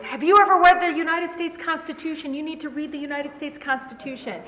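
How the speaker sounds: high, loud heckler's call-out; loud yell. Retold or imitated. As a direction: yell it out as a challenge to the speaker. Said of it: "And I'm loud".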